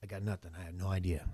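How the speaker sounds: low, very soft admission; deflated reply. Acted. Paul with no plan answering bystanders, deflated. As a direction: drop right down, as if at a loss. Acts out Paul's clueless answer.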